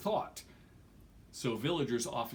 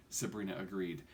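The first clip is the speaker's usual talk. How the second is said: plain reading voice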